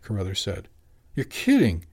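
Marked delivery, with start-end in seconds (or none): 1.1-1.8 surprised exclamation, a bit louder and higher; exclaiming. Acted. Jimmy exclaims to Carruthers at the unexpected good news, surprised. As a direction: blurt it out in happy disbelief. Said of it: "Jimmy exclaimed".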